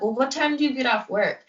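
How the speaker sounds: mild imitation of a worried mom; a touch of the mother's voice. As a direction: mimic the mom's questioning lightly. Friend's mother questioning her.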